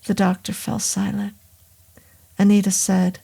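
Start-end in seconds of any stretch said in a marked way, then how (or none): none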